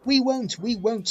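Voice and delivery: high, loud defiant trucks; mild scream. A narrator's reading. The trucks to James, high defiant cry. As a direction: shriek back stubbornly. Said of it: "screamed the trucks".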